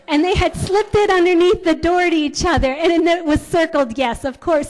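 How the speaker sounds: joyful punchline of the story; delighted reveal. Real, not acted. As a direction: build to the happy ending. Story climax, emotional reveal.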